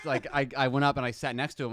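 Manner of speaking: ordinary delivery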